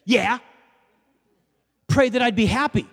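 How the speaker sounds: loud, high, mock-irritated demand; cranky waitress. Cranky waitress answering a customer's offer of prayer, mock irritated. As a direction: snap it like a crabby waitress, hammed up. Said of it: "like, cranky and just like".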